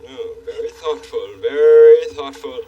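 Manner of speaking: slow, stately royal voice; pompous king. Acted. King Friday gently scolding the handyman, slow stately royal voice. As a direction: lecture like a grand, fussy monarch.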